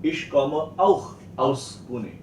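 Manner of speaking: slow sentence with auch set apart; stress on auch. Shown as a demonstration. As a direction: highlight the new word auch. Teacher introduces the word auch.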